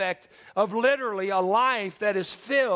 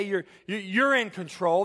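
usual speaking voice

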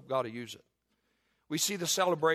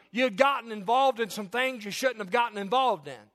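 high, loud fervent preaching; impassioned pulpit preaching; preaching at full force; 7 semitones higher, 5 dB louder than usual. Acted. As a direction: drive it home to the congregation. Preacher pressing his congregation.

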